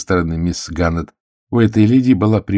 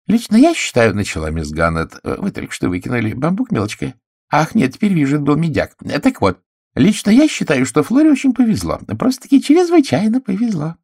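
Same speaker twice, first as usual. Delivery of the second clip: gossipy spinster; 10 semitones higher than usual. A narrator's reading. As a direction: savour the hint. Miss Gannett voiced high and loud.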